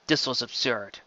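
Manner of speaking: plain reading voice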